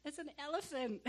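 put-on high child voice bursting into laughter; mock giggly confession, pitched well up; Shania. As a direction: imitate a kid blurting it out giggling. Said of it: "Shania was just laughing".

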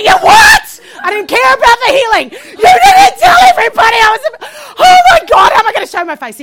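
very high, shouted mock outrage; mortified outburst. Wife finding out her husband told everyone, mortified shouting. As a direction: shriek it in comic horror, easing at the end. Acted horror at husband's revelation.

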